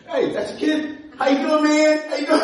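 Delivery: high, hammed-up hello to strangers; over-friendly greeting. Newcomer greeting strangers on a New York train, over-friendly.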